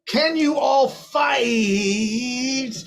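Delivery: rally the crew with a shout; shouted call. Acted. Astrid shouts to the crew before the dragon, high and slow. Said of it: "Astrid gets ready to call out".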